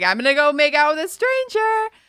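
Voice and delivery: psyched up to go kiss a stranger; excited declaration. Retold or imitated. Retelling her bold decision.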